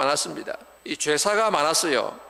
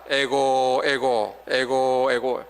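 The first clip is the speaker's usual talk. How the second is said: forced, rhythmic mock eigo wailing; a dutiful cry with no real tears, low; ritual mourning cry; 3 semitones lower, much slower than usual. Mourner at the shrine, forced ritual wailing. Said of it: "일부러라도 울어야 합니다".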